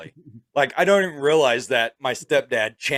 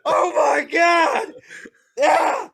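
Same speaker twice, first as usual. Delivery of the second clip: high, loud, slow laughing yells; high screaming laughter; laughing panicked shriek; 5 dB louder, much slower than usual. Retold or imitated. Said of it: "I was like".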